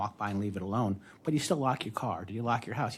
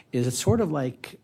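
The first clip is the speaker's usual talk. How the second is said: ordinary talk, nothing marked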